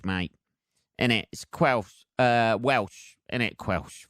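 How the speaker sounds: normal talking voice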